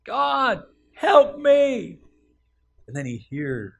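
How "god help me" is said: high, loud cry to God; desperate cry for help. Acted. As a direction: cry out as if hanging off a cliff. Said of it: "So he cries out to God".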